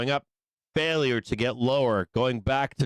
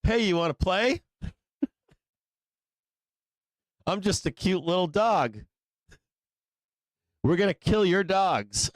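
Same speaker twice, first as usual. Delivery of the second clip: high, sugary fake-friendly voice; sly coyote voice; 8 semitones higher than usual. Coyotes luring the family dog, in a high sugary fake-friendly voice. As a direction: sweet-talk like a scheming coyote luring a pet. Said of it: "be like".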